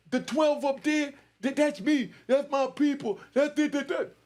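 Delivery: high-pitched, stammering shouts; mock yelling. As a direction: imitate a rapper frantically calling on his people. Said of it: "He start yelling".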